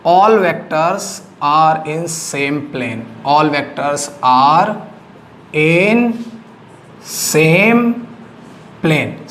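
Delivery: each word drawn out with long gaps; teacher dictating the definition slowly; slow dictation. Shown as a demonstration. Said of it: "coplanar का मतलब".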